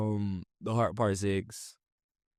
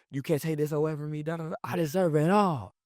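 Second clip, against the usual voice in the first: worked-up imitation of someone upset; getting upset; 6 semitones higher than usual. Imitating [name] pleading his case on the song, upset. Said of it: "as he starts getting upset".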